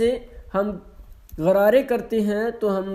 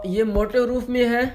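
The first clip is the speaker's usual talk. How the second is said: ordinary talk, nothing marked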